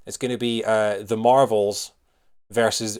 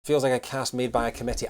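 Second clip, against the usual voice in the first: ordinary talk, nothing marked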